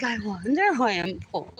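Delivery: lightly put-on voice; musing in a mild mock tone. A touch of a voice imitating herself. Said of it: "And I would be like".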